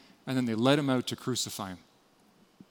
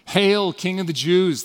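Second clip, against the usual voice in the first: slow, louder sneering hail to a fake king; mocking soldiers; 4 semitones higher, 6 dB louder than usual. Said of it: "dressed him up like a doll and played king".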